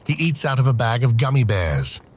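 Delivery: ordinary delivery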